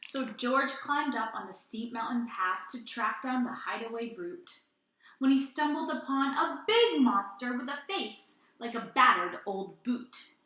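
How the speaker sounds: put-on lively read-aloud to children; animated children's story reading; storytime narration. A narrator's reading. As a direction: tell it like a picture book at storytime. Reads picture book aloud.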